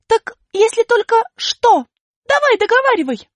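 высоко и громко, с напором; требуй договорить; настойчиво. Acted. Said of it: "настаивала Аля". (настойчивый громкий голос Али)

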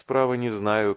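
ordinary delivery, nothing marked

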